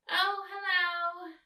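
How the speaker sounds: put-on high, slow girl voice. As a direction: call a slow hello like a girl. Coraline greeting.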